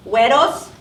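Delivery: usual speaking voice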